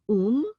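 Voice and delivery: "um" as a single loud, high syllable, u joined to m; one syllable. Shown as a demonstration. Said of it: "sound it together as one syllable".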